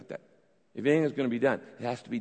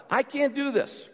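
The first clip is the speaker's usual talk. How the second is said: higher, earnest plea to God; desperate prayer, voice raised; pleading prayer; 5 semitones higher than usual. Shown as a demonstration. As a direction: cry out your helplessness to God. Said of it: "You tell God".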